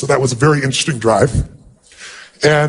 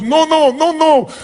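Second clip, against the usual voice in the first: very high, emphatic repeated no; the mother refusing his denial, pitched way up; insistent refusal; 11 semitones higher, slower than usual. Retold or imitated. The mother, overjoyed, refusing to accept it, very high insistent no. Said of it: "She goes".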